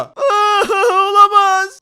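high, loud, drawn-out wail; hammed-up tearful dismay; mock wailing. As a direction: cry out in pretend despair. Forced behind the car at gunpoint.

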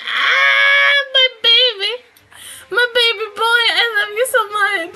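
put-on loud, high squealing baby talk; gushing to pet. Owner re-enacting gushing at her dog, loud high squeal. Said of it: "And I'm just like".

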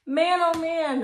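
high, loud exclamation; exclaiming. Real, not acted. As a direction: burst out in wonder. Reacting to story's end.

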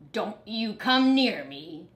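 growling warning; snarled. A narrator's reading. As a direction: snarl it like a grouchy dog. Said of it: "snarled Dog".

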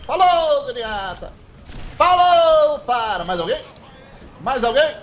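loud, high gloating goodbyes; cocky taunt. Real, not acted. Streamer bidding beaten players farewell, cocky taunt. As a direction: gloat over each kill.

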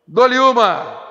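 loud auctioneer call. Real, not acted. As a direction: ring out the going-twice call. Auctioneer's going-once call. (chamada alta de leiloeiro)

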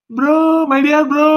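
high, loud, delighted call of bro; overjoyed hello to the younger brother; excited greeting. Acted. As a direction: greet him like a long-lost brother, voice up.

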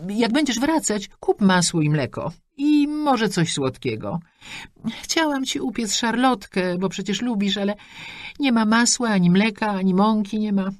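high, chatty mother rattling off wishes; a pushy mum beaming down the line; mother on the phone. Acted. Mother continues wishes and errands.

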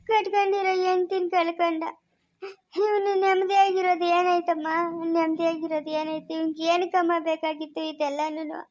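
wailing curses that sink into sobs; a mother's long, tearful lament; crying. Acted. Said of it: "ಯಾಕ್ ಅಳ್ತೀರಾ?". (ಗೋಳಾಡುತ್ತಾ ಶಾಪ ಹಾಕುವುದು)

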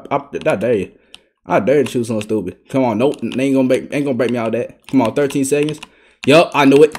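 ordinary delivery

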